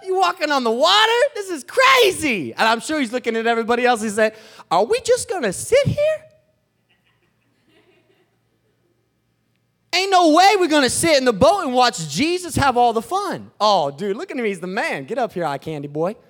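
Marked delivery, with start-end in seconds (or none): 0.0-2.5 high, loud shout of disbelief; hammed-up stunned outburst; astonished Peter. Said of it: "Peter's messed up". 4.7-13.5 high, loud incredulous protest; hammed-up outrage, voice pitched way up; indignant Peter. Acting indignant Peter to others.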